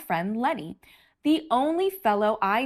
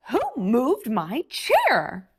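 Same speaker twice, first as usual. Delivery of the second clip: slow, louder snappish whine; cranky demand; snappy; 2 semitones higher, 4 dB louder, slower than usual. Acted. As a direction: snap it at whoever moved it. Snappy grape complaining.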